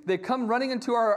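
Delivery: usual speaking voice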